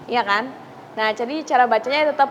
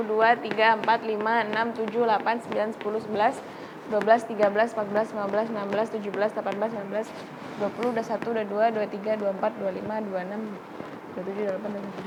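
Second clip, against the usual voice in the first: quiet counting; 3 semitones lower, 8 dB quieter, much faster than usual. Real, not acted. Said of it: "counting letters softly".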